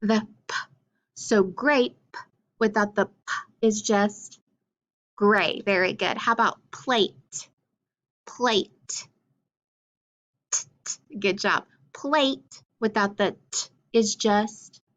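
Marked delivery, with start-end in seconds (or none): 0.5-0.5 unvoiced "p" puff, no vowel; whispered pop of p; isolated letter sound. Shown as a demonstration. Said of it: "what's my final sound?". 10.5-11.2 unvoiced "t" taps, no vowel; whispered final t sound; isolated letter sound. Shown as a demonstration. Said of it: "taking off that final sound".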